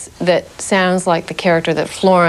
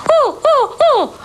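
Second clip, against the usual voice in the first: very high piping ooh; bird-sound warm-up. Shown as a demonstration. Actress showing her vocal warm-up, very high ooh sounds. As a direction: hoot up high like a little bird. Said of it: "I'll try to do this without breaking sound equipment".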